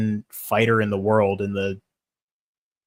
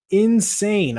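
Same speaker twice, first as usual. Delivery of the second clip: Insane. said much higher and louder, drawn out; excited insane; 9 semitones higher, 4 dB louder than usual. Real, not acted. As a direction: burst out with Insane.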